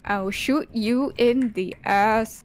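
slow, deliberate mock threat; slow drawn-out threat. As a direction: stretch every word out. Threat repeated slowly.

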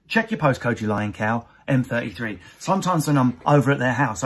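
ordinary talk, nothing marked

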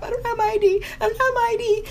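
high, panicky squeal; panicked. Retold or imitated. At the tow window, a high panicked wail. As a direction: voice jumps up in panic.

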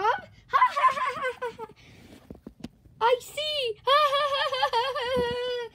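loud, high acted "ha ha ha"; jeering laughter. Toadette and Tom laugh at the naked gorilla, loud mocking laughter. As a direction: laugh loudly at someone, hammed-up.

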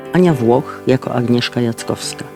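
ordinary talk

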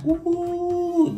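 very high whooping cry; excited whoop. Real, not acted. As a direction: whoop it up, thrilled. Excited exclamation about new word.

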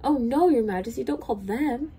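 high, urgent storybook plea; alarmed pleading. A narrator's reading. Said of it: "Bartholomew shivered".

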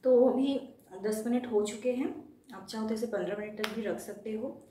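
limited facial movement; careful stiff lip movement; speaking with sheet mask on. Real, not acted.

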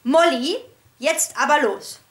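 loud firm call; energetic call. A narrator's reading. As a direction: call the dog to come now. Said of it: "rief energisch".

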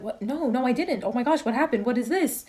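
high, flustered denial and questions; baffled, tired after work; retold confused surprise. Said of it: "And I was, I was like".

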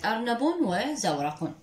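أرنب وزورق pronounced arnabun, zawraqun; tanwin damm endings. Shown as a demonstration. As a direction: give أرنب وزورق final -un sounds. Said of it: "تنوين الضم مثل كلمة".

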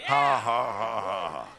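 deliberate ha-ha laugh. Shown as a demonstration. Preacher showing the church how to laugh, laughing. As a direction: demo laugh for the congregation. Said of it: "practice laughing for a minute".

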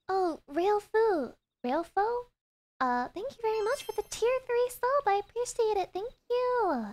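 high, bright gushing thanks; excited. Real, not acted. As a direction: squeal the thanks.

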